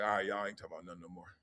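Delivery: fast, very soft throwaway; quick brush-off. Retold or imitated. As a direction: mutter it fast and quiet. Dipping out of a clip.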